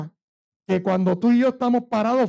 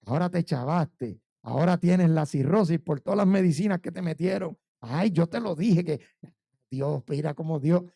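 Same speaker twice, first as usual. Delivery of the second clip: devil's taunting voice; 6 semitones lower than usual. Retold or imitated. Pastor voicing the devil taunting him in his fear, low and sneering. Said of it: "el diablo empezó a hablarme".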